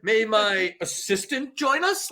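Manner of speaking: hammed-up haughty Scotty; imitating Scotty. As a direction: ask while looking down at Bones. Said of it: "he's like".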